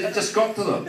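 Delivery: normal talking voice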